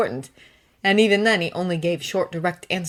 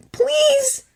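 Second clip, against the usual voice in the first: pleading; 3 dB louder than usual. Acted. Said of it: "the plea is there in his voice".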